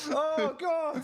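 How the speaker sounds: mock despair. Mock despair in comic bit.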